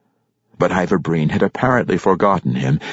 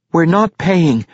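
put-on high boyish shout; 11 semitones higher, 4 dB louder, slower than usual. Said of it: "Regis shouted".

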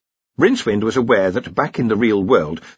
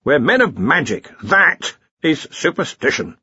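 loud, raised commanding voice; brisk Arch-Chancellor; 7 semitones higher, 3 dB louder than usual. Acted. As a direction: take charge of the meeting loudly. Arch-Chancellor dismisses the idea.

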